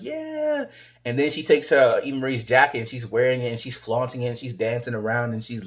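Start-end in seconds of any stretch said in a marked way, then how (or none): 0.0-0.6 crowd cheer. Retold or imitated. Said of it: "they're like".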